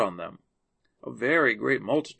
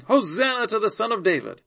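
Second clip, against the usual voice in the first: higher, louder narrated hosanna; crying out praise; 7 semitones higher, 5 dB louder than usual. A narrator's reading. Children hailing Jesus in the temple, crying out praise. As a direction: call out the praise with a raised voice. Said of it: "crying in the temple and saying".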